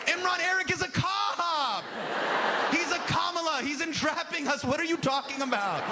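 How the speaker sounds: high, urgent mock panic; panicked shout. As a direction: blurt it out like a frightened friend. Panicked friends shouting in story.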